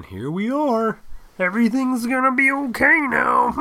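high, loud, sing-song mock of a satisfied rich guy; mocking fantasy voice.

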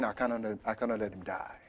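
low, soft repeated plea; quiet praying. Retold or imitated. Man overhearing his pastor praying for him, low soft plea. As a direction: pray it low, under the breath. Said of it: "he heard his pastor praying".

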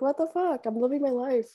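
squeaky put-on voice of the baby; high mock baby voice; voicing the baby. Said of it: "He's like".

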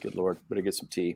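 ordinary talk, nothing marked

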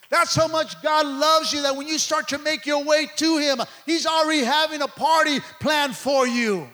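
big celebratory pulpit delivery; full-force preaching. Real, not acted. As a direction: proclaim it jubilantly to the congregation.

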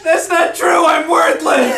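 very high, wailed self-pity; hammed-up tearful anguish; weeping despair. A narrator's reading. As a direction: wail it as if sobbing. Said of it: "he starts weeping".

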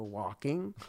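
low, flat-toned lightly voiced inmate; deadpan prisoner. Prisoner answering cellmate with a low deadpan word. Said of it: "And you go".

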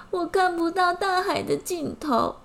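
high, a touch of mock tearfulness; tearful ant. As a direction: whimper it like a sad little ant. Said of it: "蚂蚁哭着说".

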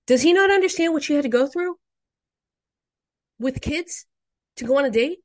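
high, loud exasperated outburst; real frustration. Real, not acted. Podcaster venting at commenters, high and exasperated. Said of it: "if you hear the frustration in my voice".

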